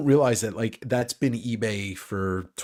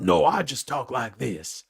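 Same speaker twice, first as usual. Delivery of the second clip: slow cartoon Southern drawl; lazy, exaggerated Southern twang; Foghorn Leghorn; slower than usual. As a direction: answer in a put-on Southern accent. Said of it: "talks like Foghorn Leghorn in my mind".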